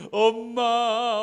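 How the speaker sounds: high, loud, drawn-out call for mother; mock wailing child. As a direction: wail it long, like a hurt boy. Joseph crying for dead mother. (엄마를 길게 부르며 우는 시늉)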